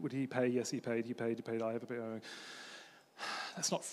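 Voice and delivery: ordinary talk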